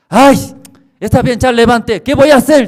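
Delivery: high, loud whiny complaint; woman woken at night, high and loud; annoyed sleeper. Retold or imitated. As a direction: push the voice away, half asleep. Woman giving in.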